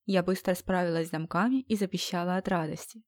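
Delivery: plain reading voice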